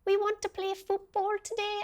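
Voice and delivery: put-on a playful, off-the-mark Welsh kid voice; Welsh child accent. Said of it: "I don't know what that accent was".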